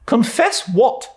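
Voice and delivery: put-on abrupt old lady; demanding. A narrator's reading. Miss Barry to Anne, demanding bluntly.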